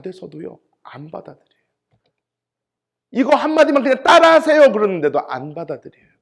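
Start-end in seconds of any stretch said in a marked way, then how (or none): 3.1-4.7 loud, high, insistent plea; desperate urging. Retold or imitated. Evangelist pleading with a dying unbeliever, loud and urgent. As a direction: beg them at full voice to repeat it.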